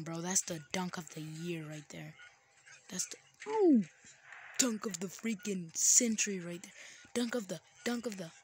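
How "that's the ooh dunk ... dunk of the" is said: excited hype. Real, not acted. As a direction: hype it up like a highlight. Hyping a dunk.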